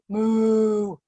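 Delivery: low mooing like a cow. Shown as a demonstration. Teacher showing kids the cow pose sound, lightly mooing. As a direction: moo like a gentle cow. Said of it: "and say".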